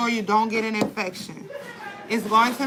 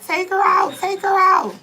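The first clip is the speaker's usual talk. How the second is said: high, pleading yell; half-laughing mock scream; retold scream; 9 semitones higher, 4 dB louder than usual. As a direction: beg them loudly to take her out. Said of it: "I screamed".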